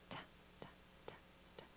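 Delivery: soft unvoiced ta ta ta; whispered rhythmic pats. Shown as a demonstration. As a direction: tap out ta syllables in a whisper. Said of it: "let's just pat a little bit".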